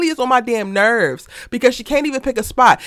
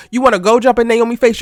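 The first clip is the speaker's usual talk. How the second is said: usual speaking voice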